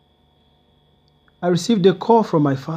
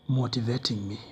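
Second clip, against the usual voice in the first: normal talking voice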